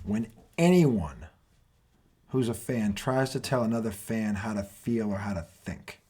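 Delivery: slow, deliberate build into a rant; rant opener. Acted. Host opens his tonight rant segment, slow and deliberate. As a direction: set up the rant, taking time.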